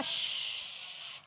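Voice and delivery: a long whispered shh; shushing. Real, not acted. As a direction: hush the class with a breathy shh. Hushing noisy children. (mendesis menyuruh diam)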